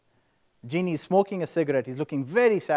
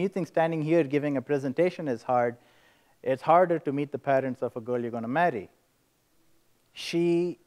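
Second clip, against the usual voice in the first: normal talking voice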